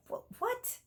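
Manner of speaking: high, soft squeak of disbelief; stunned little squeak; shocked disbelief. Retold or imitated. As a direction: gasp it out like you can't believe it. Mock disbelief at husband's cooking.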